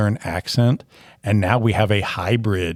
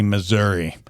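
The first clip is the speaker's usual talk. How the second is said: ordinary delivery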